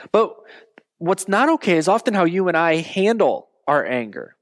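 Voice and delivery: ordinary talk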